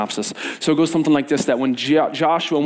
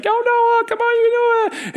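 high yelled cheer from below; cheering shout. Retold or imitated. Counselors shouting up to the frozen boy, high cheering yell. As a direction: yell up the tower to cheer him on. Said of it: "we're screaming, losing your voice".

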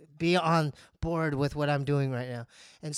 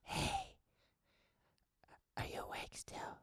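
whisper; 2 semitones higher, 14 dB quieter than usual. Retold or imitated. Whispering to her to come down, hushed. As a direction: coax in a furtive whisper. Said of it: "I whispered".

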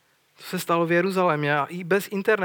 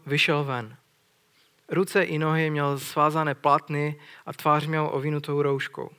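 normal talking voice

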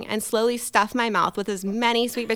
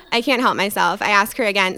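ordinary talk, nothing marked

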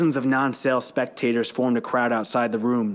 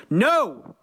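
sharp group shout, pitched high; 8 semitones higher than usual. A narrator's reading. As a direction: bark the answer back. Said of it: "more people shouted".

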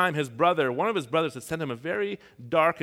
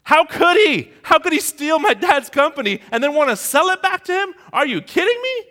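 much higher, much louder furious outburst; fuming anger; 14 semitones higher, 11 dB louder than usual. Retold or imitated. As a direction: relive the rage, shouting high. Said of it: "I was fuming".

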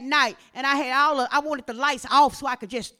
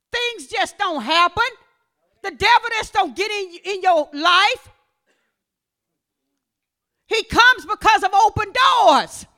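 raised voice, slow, weighty phrases; forceful preaching; 6 semitones higher, 8 dB louder than usual. Acted. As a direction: drive each phrase home, ending in a shout.

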